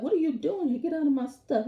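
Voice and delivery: mock brother chasing him out; angry teen. Tate yells at Michael in his room, mock angry. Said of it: "Tate's like".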